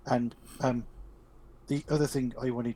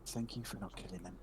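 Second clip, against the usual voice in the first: very soft, lowered whisper; 4 semitones lower, 13 dB quieter than usual. Acted. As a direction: whisper it privately into a friend's ear. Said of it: "and whisper".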